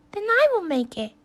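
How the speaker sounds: bright high little hen, decided. A narrator's reading. Hen telling the lazy animals she'll do it herself, high and firm.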